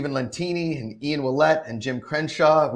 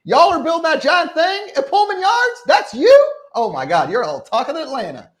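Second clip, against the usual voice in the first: high, loud, amazed Southern gush; excited Atlanta local; 12 semitones higher, 8 dB louder than usual. Retold or imitated. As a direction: gush like a thrilled local homeowner. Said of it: "He's like".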